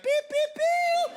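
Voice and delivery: very high, drawn-out loud beeps; imitated beeping. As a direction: squeal slow mock machine beeps. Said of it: "then I translate it, and it'd be like".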